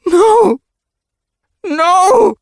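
very high, loud wail of grief; anguished shriek. A narrator's reading. Mother seeing her dead son, anguished shriek. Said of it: "let out an anguished shriek".

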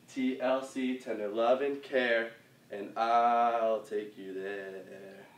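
drawn-out words, stretched to the beat; slow lyric recital. Shown as a demonstration. Dance teacher going through the song's words with moves, slow and stretched. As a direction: mark the lyrics slowly, lingering on the last line.

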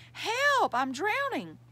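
high, loud acted alarm; crying out for help. A narrator's reading. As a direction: call out as if drowning, first word highest. Rabbit pretends to be drowning.

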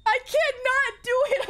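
high, teary cries of giving up; scared laugh-crying. Real, not acted. Gamer too frightened to go on, laugh-crying. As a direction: whimper it through nervous laughter.

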